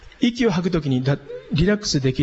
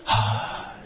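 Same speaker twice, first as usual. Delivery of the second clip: short, much softer, lower "haa"; quick soft puff on the out-breath; short soft exhale; 7 semitones lower, 6 dB quieter than usual. Shown as a demonstration. Teacher showing short breaths between inhales, softly. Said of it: "今度は短い音で".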